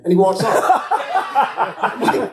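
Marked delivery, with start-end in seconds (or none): 0.6-1.8 high burst of real laughter; laughing at the absurd remark. Real, not acted. Laughing at the memory.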